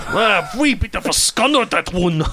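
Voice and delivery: high comic Scottish brogue; mock Scottish accent. Host answering the co-host's Freedom joke, mock Scottish accent. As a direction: pile on the playful Scots. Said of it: "objectively funny accent".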